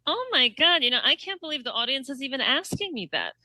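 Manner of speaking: exaggerated high politician mimicry; politician impression. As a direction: impersonate her gushing at the audience, voice lifted.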